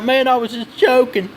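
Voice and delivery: imitating the bully. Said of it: "He's like".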